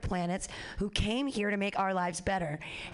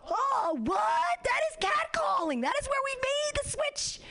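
outraged shock; 12 semitones higher than usual. Real, not acted. Telling the crowd about a gross catcaller, outraged. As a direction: hit every word shocked and offended.

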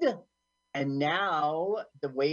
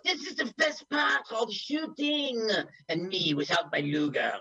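higher-pitched mock gleeful soldier; movie character quote; 7 semitones higher than usual. Friend quoting a movie henchman, higher mock glee. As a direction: chirp it like a goofy henchman. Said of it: "this is when he goes".

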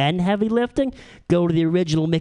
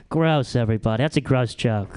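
ordinary talk, nothing marked

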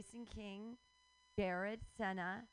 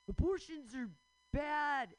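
loud, higher sarcastic parroting of a preachy opinion; mocking quote; 6 semitones higher, 6 dB louder, slower than usual. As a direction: mimic them with scorn, louder. Mocking anti-abortion people.

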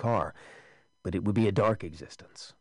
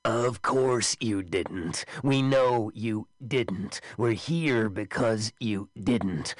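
raised voice; 2 semitones higher, much slower than usual. Acted. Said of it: "The thing raised its voice".

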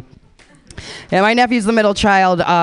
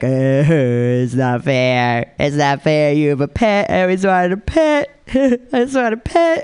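mock crying nephew; 5 semitones lower than usual. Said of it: "he cried when he met her".

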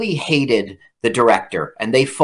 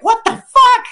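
much higher, louder indignant shout; outraged outburst; 5 dB louder than usual. Retold or imitated. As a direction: blurt it out in disbelief. Harvey outraged at pay gap.

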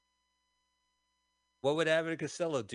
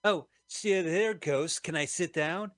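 startled Costello voice; 6 semitones higher, 4 dB louder, slower than usual. Retold or imitated. Voicing Costello seeing the ghost.

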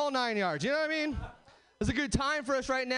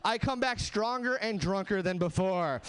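ordinary talk, nothing marked

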